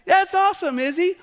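put-on high, loud little-kid cheer; little boy. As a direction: pipe up as an excited small boy. Dad voices son echoing him.

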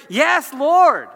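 loud, high, ringing response; emphatic acclaim. Acted. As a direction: answer with full-voiced conviction. Acts out emphatic answer to God.

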